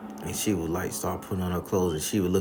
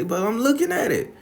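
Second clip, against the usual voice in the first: put-on high, louder boy protest; kid protesting; 5 dB louder than usual. Boy protesting to grandma, high and loud. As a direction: whine it like a caught kid. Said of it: "I say".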